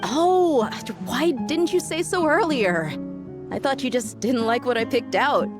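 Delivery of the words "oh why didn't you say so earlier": high, drawn-out realization; relieved surprise. Acted. Friend learns why the gifted clothes were passed on, relieved surprise. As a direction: let the "oh" rise high as the misunderstanding clears.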